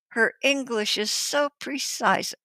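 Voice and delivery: usual speaking voice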